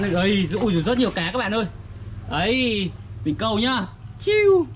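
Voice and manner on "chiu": high squeaky whoosh for the cast; mouth sound effect. Acted. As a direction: zip the line out with a high "chiu". Toy fishing, casting sound. (tiếng vút cao)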